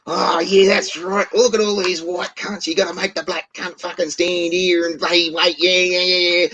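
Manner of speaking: high, hammed-up angry woman ranting in the queue; shrill put-on voice going off her brains; mock angry rant. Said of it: "goes off her fucking brains like".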